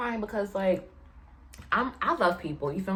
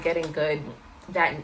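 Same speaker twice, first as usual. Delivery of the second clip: ordinary talk, nothing marked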